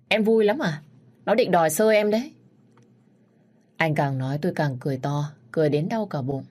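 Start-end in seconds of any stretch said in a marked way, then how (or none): 0.1-2.3 higher, louder indignant questioning; raised, rising voice of mild annoyance; annoyed scolding. A narrator's reading. Annoyed scolding of laughing listener.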